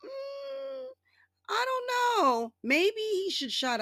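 very high, slow sarcastic hums; sarcastic musing. Retold or imitated. Mocking Trump's scandals to viewers in very high, slow sarcasm. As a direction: ham up mock innocence. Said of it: "and I'm like".

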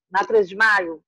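ordinary talk, nothing marked

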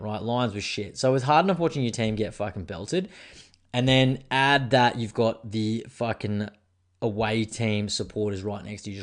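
ordinary talk, nothing marked

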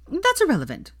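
high, brushing it off; dismissive. Acted. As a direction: wave it away breezily. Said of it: "said Veronica dismissively".

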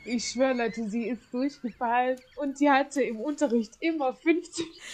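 ordinary talk

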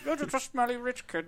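high mocking villain sneer; Bane impression. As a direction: taunt him in a put-on voice. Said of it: "That's my Bane".

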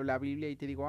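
ordinary talk

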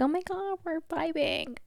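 high, drawn-in squeal of youthful delight; excited nineteen-year-old self. Acted. Nineteen-year-old self cheering on the present self, excited and high. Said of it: "nineteen-year-old me, it's like".

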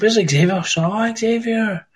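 high-pitched, drawn-out mock surprise; retold surprise. Scot retells spotting a friend in chat, high surprised voice. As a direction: call the name high, stretching it out.

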